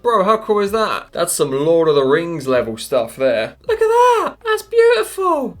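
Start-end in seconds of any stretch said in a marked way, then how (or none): 3.7-5.6 very high, loud squealing wonder; high-pitched amazed squeal; awed squeal. Real, not acted. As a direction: gasp it out in delight. Awed at glowing bay.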